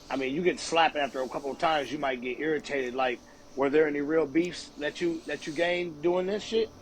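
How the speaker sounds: ordinary delivery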